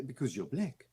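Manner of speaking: put-on soft South African accent on a film line; quiet, deadpan comic South African delivery. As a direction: say it softly, South African style. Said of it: "doing it in the South African".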